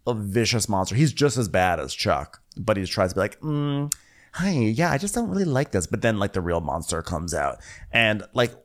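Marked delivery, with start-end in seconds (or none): 3.4-5.9 high, softened mock sweetness; fake nice. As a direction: imitate a guest pretending to be nice. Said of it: "he does the fake nice thing".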